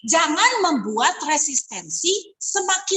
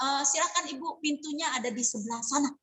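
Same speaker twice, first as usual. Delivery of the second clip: much softer, a mock-polite brush-off; rude dismissal; 2 semitones lower, 9 dB quieter than usual. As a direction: show the client the door with fake courtesy. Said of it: "Gitu, nggak sopan".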